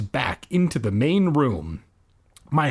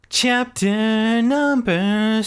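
chapter announcement; 6 semitones higher, much slower than usual. Acted. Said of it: "announcing chapter".

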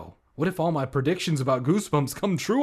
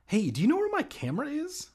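high fast question; 14 semitones higher, faster than usual. Acted. Hammed-up quick question.

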